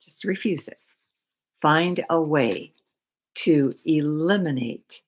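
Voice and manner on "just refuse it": soft secret whisper of advice. Shown as a demonstration. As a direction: whisper the line.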